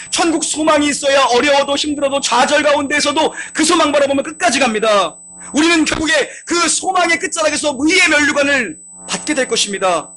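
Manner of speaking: fervent pulpit build-up; preaching at full force. Acted. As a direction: preach the hope toward a shouted crown. Rising sermon climax.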